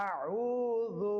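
slow Quranic recitation of a single word; careful demo recitation. Shown as a demonstration. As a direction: stretch আউযু slowly, pressing the throat. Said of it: "এখন একটা করে শব্দ উচ্চারণ করব".

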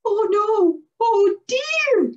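put-on high, loud alarmed young tree spirit; panicked cry. A narrator's reading. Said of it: "he cried".

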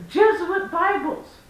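a mild mock of his pious answer; imitating the Bible handout guy; mimicking the stranger. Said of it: "And he says".

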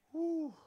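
loud triumphant whoop. Real, not acted. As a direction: let out a big gym whoop. Gym shout after a heavy set.

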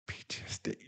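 hushed, ashamed; whisper. Acted. Admitting a diagnosis to his partner, whispering. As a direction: barely say the letters aloud.

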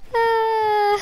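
high breathless giggling; can't stop laughing at the prank; prank reveal laughing. Real, not acted.

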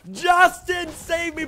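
high, shouted cry for rescue; desperate plea. Real, not acted. Streamer begging Justin to save them, desperate shout. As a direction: call out urgently for help.

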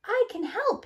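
a little truck begging to join in; eager exclaim. A narrator's reading. Bulldozer eagerly offering help to the big trucks. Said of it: "he exclaimed".

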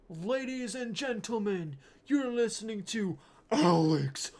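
loud, high announcer roar sinking into a growl; huge drawn-out hammed-up stage introduction. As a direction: bellow it like a metal frontman introducing himself. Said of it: "that's my impression of [name]".

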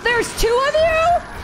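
high-pitched incredulous question; alarmed disbelief. Real, not acted. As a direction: squeal it in dismay. Second ghost appears in game.

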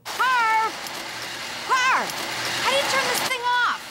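calling out. Real, not acted. Calling out to husband.

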